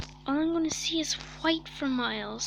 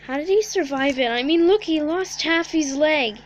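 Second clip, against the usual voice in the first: incredulous; 3 semitones higher, 7 dB louder than usual. Real, not acted. Amazed at survivor.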